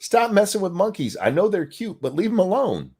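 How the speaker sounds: high, urgent plea to stop; pleading stop. Real, not acted. Pleading to people who mess with monkeys, high and urgent. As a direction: beg them to quit it, voice up high.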